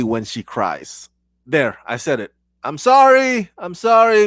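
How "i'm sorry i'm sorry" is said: high, pleading sorry; exaggerated apology. Real, not acted. Host apologizing for saying she looks ugly, pleadingly. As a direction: apologize in a raised, defensive pitch.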